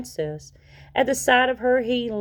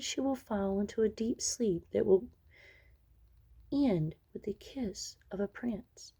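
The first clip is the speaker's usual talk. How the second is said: soft, gentle fairy voice; a kind fairy speaking softly to comfort the court; 3 semitones higher, 7 dB quieter than usual. Acted. Kind fairy softens the curse.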